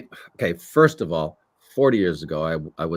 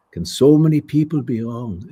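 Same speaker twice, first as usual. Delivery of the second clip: high, loud, impassioned question; heartfelt plea; 6 semitones higher, 7 dB louder than usual. Acted. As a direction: plead with Logan in the character's voice. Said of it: "doing this kind of heartfelt".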